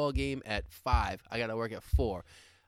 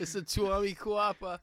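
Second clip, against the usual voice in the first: put-on Boston accent; 7 semitones higher than usual. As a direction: belt it like a proud Bostonian. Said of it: "in the Boston accent".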